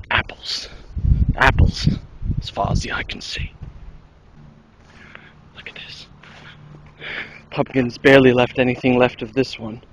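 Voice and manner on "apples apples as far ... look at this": hushed whisper, hiding. Pretend. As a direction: whisper so the pumpkins won't hear.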